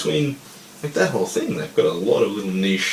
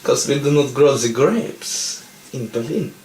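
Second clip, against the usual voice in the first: lightly put-on German accent, musing to Hans; a hint of a German general in wartime; mock German officer; 3 semitones higher than usual.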